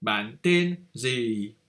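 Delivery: Ban ten zi said slowly with clear tones; slow Vietnamese phrase. Shown as a demonstration. Teacher models the question what is your name. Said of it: "toi, nommé quoi".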